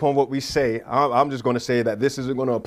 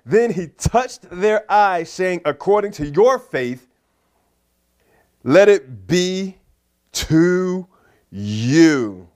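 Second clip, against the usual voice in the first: loud, very slow preacher's reading; emphatic scripture reading; 2 semitones higher, 4 dB louder, much slower than usual. Acted. Preacher reads Jesus touching their eyes, weighty declaration. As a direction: stretch each phrase out with force.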